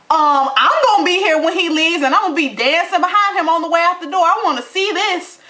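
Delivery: excited and defiant. Real, not acted. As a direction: gloat that you'll be there watching. Answers the quote, defiant.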